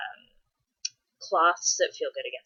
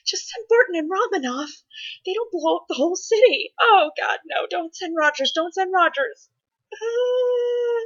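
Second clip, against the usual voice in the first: exasperated movie rant; 12 semitones higher than usual. Retold or imitated. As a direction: plead with the heroes in rising panic. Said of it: "like, going".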